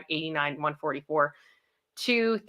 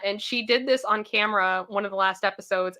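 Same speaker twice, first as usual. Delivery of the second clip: usual speaking voice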